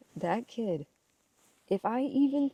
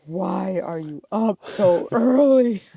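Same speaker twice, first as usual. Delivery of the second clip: put-on slow, louder little girl voice with a laugh; imitated child question; 3 semitones higher, 11 dB louder, slower than usual. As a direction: drag it out like a puzzled kid. Imitating daughter puzzled at dawn.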